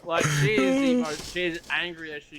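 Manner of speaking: can barely get it out for laughing; laughing while telling. Real, not acted. Telling his mates about the reversing car, laughing hard.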